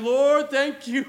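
loud, high, stretched-out cry of thanks; hammed-up grateful exclamation; exaggerated relieved thanks. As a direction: play up huge relief to God. Acted relieved prayer after danger.

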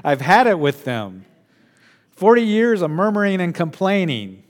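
loud, fed-up rant; preacher pretending to be Moses at the end of his rope; exasperated outburst. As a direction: let the frustration burst out loud. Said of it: "I'm just being honest with you".

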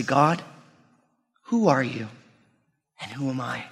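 soft, low question to God, fading away; dialed-back prayer. Acted. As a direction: ask God humbly, with long pauses. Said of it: "We need to dial that back".

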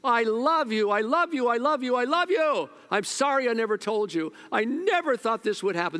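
high, loud anguished cry of love at a graveside; screaming at the casket. Retold or imitated. Mourner at a funeral screaming to the dead: loud anguished cry. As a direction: wail it out over the casket, full of grief. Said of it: "And they scream out".

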